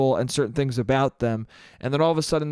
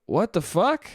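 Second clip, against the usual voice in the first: mock disgusted disbelief, rising at the end; disgusted question with an upward swing; 3 semitones higher than usual. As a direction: retell a blurted, incredulous reaction. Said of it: "just a disgusted".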